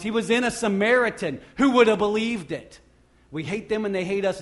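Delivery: loud, building sermon climax; preaching at full force. Real, not acted. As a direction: drive it home from the pulpit.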